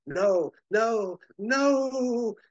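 a mad genius losing control, climbing into a shriek; panicked villain cry. Acted. Ultra Humanite backs away from Superman, rising panicked no's.

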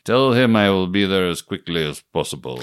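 put-on low lightly Russian-accented line; hint of a Russian villain. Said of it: "your Russian accent was better".

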